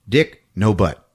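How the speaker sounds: usual speaking voice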